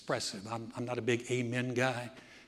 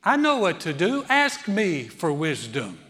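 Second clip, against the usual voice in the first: loud, assured voice, slowing at the end; hammed-up divine reassurance; voicing God; 5 semitones higher, 9 dB louder than usual. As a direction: say it as a loving father who knows. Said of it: "he says".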